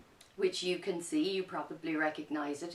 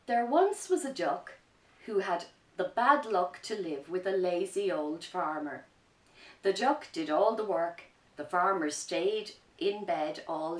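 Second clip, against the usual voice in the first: lively picture-book narration, a bit louder; reading to children; 7 dB louder than usual. A narrator's reading. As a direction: tell the story with energy for kids.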